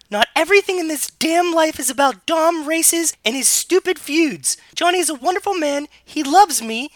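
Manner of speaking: loud, very high put-on girl's rant; furious Ellie falsetto. Younger sister defending her boyfriend, loud indignant falsetto. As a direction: rant at full tilt in falsetto.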